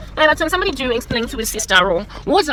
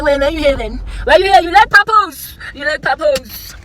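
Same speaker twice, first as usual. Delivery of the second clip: raised, loud sassy retort; mock indignant retort; 4 semitones higher, 6 dB louder than usual. Retelling a snappy reply to a stranger, loud and sassy. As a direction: throw it back at them with sass. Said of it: "I'm like".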